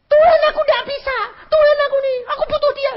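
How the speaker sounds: mock desperate begging to God; whining prayer. Wife begging God for husband, mock whine. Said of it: "Lah kok kamu ngomel?".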